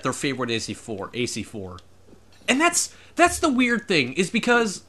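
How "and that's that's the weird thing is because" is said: high-pitched, excited rush of explanation. Real, not acted. Streamer getting carried away while explaining, excited high voice. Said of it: "I get a little excited".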